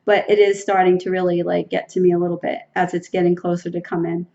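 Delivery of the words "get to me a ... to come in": ordinary talk, nothing marked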